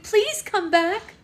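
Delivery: a bride's last loud plea; desperate pleading. A narrator's reading. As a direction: stretch out the begging. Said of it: "she pleaded".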